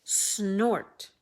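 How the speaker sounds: loud mock pig snort. Pig noise in story.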